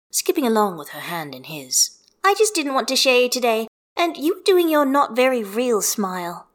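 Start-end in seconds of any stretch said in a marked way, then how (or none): 2.2-6.5 a hint of a cheerful child, a bit higher; chirpy kid teasing her daddy; light little girl voice. A narrator's reading. Child figure Hestia.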